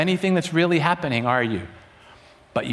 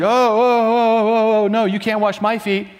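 flustered protest; 5 semitones higher, 3 dB louder than usual. Retold or imitated. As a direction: protest in shocked embarrassment. Peter refusing the foot washing.

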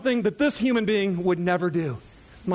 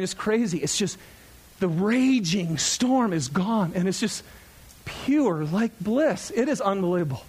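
ordinary talk

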